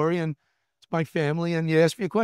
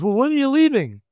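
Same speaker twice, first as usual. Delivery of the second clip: higher, louder alarmed question; imitating wife's shock; 10 semitones higher, 7 dB louder than usual. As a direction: voice the wife caught off guard.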